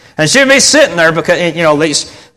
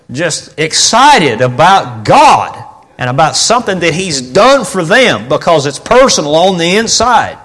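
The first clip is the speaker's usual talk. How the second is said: ordinary talk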